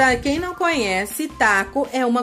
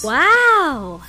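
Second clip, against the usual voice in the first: amazed wow; 3 semitones higher, 4 dB louder than usual. Acted. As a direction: gasp at the pretty colour. Second voice marvels at colour.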